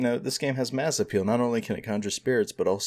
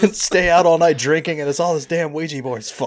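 hammed-up angry complaint, raised; mock outraged review; 6 semitones higher, 7 dB louder than usual. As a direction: rant like a ruined man.

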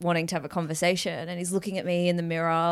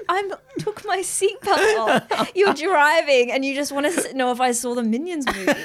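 laughing, raised-pitch retelling; laughing disbelief; 6 semitones higher, 4 dB louder than usual. Retold or imitated. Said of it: "It's just like".

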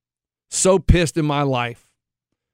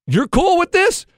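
much higher, incredulous questions; mock disbelief; 13 semitones higher than usual. Asking the other team's coach if the trash talk is okay, high disbelief.

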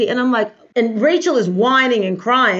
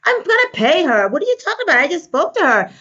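higher-pitched imitated protest; defensive retort; 7 semitones higher than usual. Quoting the woman confronted about money, mock indignant. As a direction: act out an indignant comeback. Acts out her defensive reply.

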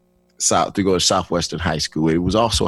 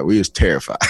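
ordinary delivery

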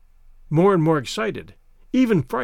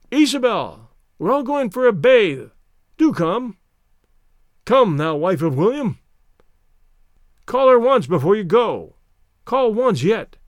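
raised, louder calling voice; calling from below; 4 semitones higher, 4 dB louder than usual. A narrator's reading. Friends in the garden urge Isabel to bathe, calling up. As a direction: call up to someone indoors, pitched high. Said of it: "from the garden below there came voices".

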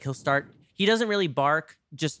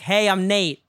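a louder, relaxed hello in a New York accent; confident New York intro; 5 semitones higher, 6 dB louder than usual. Shown as a demonstration. Host now, comfortable, in a louder New York accent. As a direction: greet them like a New Yorker at home. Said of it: "And I'm like".

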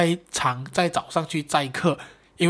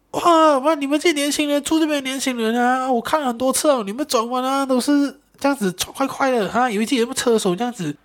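much higher, hammed-up angry lecture; scolding older brother; 9 semitones higher than usual. Rider's older brother scolds the young driver, hammed-up angry scolding. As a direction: wag your finger like an irate big brother. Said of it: "他哥哥又开始屌我".

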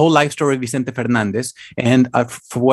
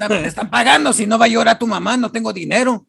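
uncle voice; 6 semitones higher, faster than usual. Pretend. Uncle explaining the gig.

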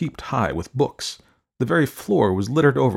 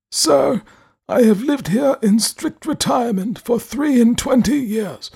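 put-on high, slow, stern old man voice; grave elderly recluse speaking; 13 semitones higher than usual. A narrator's reading.

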